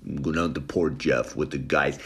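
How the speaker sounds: low, quiet husband's reply; subdued husband. Acted. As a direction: answer the wife low and soft. Meek husband answers nagging wife.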